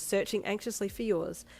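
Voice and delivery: ordinary delivery, nothing marked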